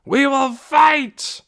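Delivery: slow, high, loud battle roar; warriors' roar. A narrator's reading. Warriors backing Tick with a roar. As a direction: roar it back as one. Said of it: "roared the warriors".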